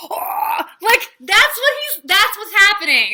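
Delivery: high, loud burst of delight; gleeful. Real, not acted. Fan gushing to viewers about the wordplay, high and loud. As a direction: squeal it out with joy.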